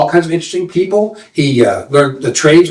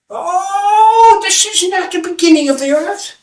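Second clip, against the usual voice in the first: high, mock-wailing reply; teasing old seer; slower than usual. Seer answering the angry young man, high and teasing. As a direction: play innocent.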